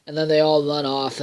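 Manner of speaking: ordinary talk, nothing marked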